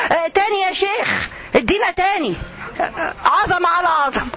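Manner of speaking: high, put-on cry of rapture at the recitation; mocking ecstatic listener. Preacher mocking listeners who shout praise at a recitation, high and put-on. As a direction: ham up a fan swooning at a reciter. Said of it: "الناس اللي تذكر بصوت عالي".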